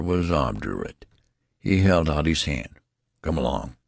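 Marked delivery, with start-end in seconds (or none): 3.2-3.8 low-pitched, understated sternness; stern. A narrator's reading. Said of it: "he said sternly".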